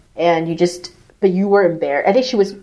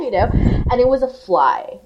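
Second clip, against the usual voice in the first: ordinary talk, nothing marked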